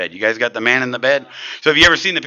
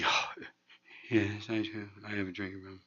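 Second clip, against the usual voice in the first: much softer, defeated little voice; beaten-down mumble; 3 semitones lower, 17 dB quieter than usual. Retold or imitated. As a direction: mumble it like you've given up. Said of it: "That's what I sounded like".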